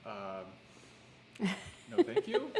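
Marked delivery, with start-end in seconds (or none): 1.9-2.6 laughing. Real, not acted. Declining a hot pepper, laughing.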